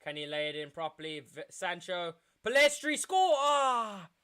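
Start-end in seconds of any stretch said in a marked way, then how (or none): 2.4-4.1 much higher, louder yell at the chance; real frustrated scream; excited shout. Real, not acted. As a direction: urge him to score. Live commentary excitement.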